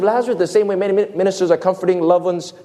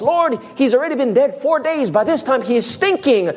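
higher-pitched protesting voice; acted protest; 7 semitones higher than usual. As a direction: object urgently, as the onlookers. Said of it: "they begin to protest".

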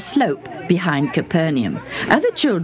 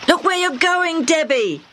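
warning shout; 12 semitones higher than usual. Acted. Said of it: "Hammy shouted".

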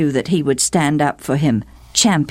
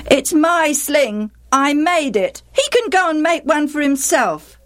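put-on higher-pitched, stubborn boy; defiant boy; 12 semitones higher than usual. A narrator's reading. Jephthah defending his sling to stepmother, defiant. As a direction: stand your ground in a raised boyish voice.

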